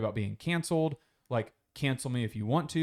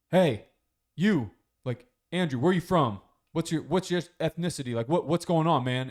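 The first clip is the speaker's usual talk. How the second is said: loud, high, in-your-face questioning; loud pushy guy; 5 semitones higher, 5 dB louder than usual. Retold or imitated. Streamer guy loudly grilling Andrew about ethnicity. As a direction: bark it out like a rude chat bro. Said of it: "this one guy just goes".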